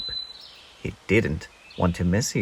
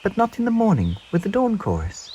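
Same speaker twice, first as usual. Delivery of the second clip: small bird voice; 10 semitones higher than usual. Acted. Peep voiced high.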